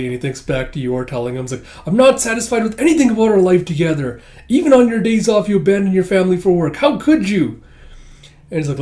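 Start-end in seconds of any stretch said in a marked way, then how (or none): 1.9-7.6 high, loud mock reproach; upset complaint. Wife reproaches her husband over family time, loud mock upset. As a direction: scold him in a hammed-up wife's voice.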